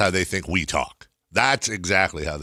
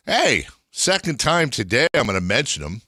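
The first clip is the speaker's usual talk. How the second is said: ordinary talk, nothing marked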